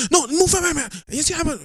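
hammed-up angry brush-off; angry coworker. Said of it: "I, like".

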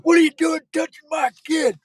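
high-pitched shouted confrontation, mock fury; hammed-up furious shout; outraged parent. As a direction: yell like a parent storming at a stranger. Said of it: "come running out of a tent going".

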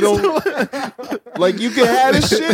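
high, loud laughing mock threat. Threatening landlord voiced, laughing.

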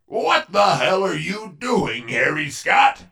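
ordinary delivery

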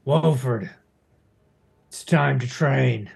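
deep gruff Bacho. Acted. Gm voices Bacho.

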